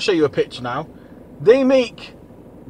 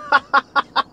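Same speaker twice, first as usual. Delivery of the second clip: high laugh; 7 semitones higher than usual. Real, not acted. Laughing at own joke.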